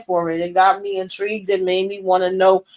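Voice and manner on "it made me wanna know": usual speaking voice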